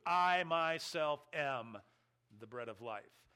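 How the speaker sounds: "I myself am" very slow, weight on "I"; emphatic I myself. Shown as a demonstration. Preacher demonstrates the emphatic pronoun, slow and stressed. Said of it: "puts a pronoun and an emphasis to it".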